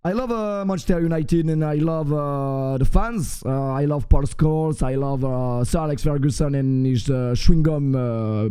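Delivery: put-on low, hesitant English with a heavy French accent. Streamer declaring love for club and fans, French-accented English. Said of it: "je suis en anglais".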